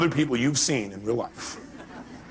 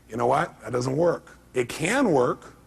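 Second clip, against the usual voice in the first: ordinary talk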